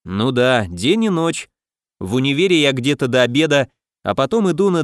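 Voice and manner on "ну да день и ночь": usual speaking voice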